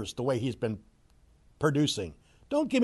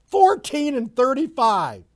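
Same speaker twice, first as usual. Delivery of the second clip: incredulous repeat; 10 semitones higher, 6 dB louder than usual. Real, not acted. Repeating the losing record in disbelief.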